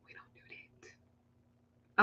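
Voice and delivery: slow hushed whisper; whispered aside. Real, not acted. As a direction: breathe it like a secret. Aside denying witchcraft.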